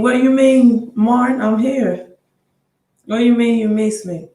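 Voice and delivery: surprised. Real, not acted. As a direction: react with startled disbelief to a fan's message. Host surprised by viewer's comment.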